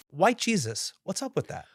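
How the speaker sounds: usual speaking voice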